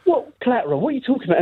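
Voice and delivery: high-pitched mock incomprehension; baffled reaction. People questioning the caller's odd word, mock bafflement. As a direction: query it sharply upward, confused. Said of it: "people would go".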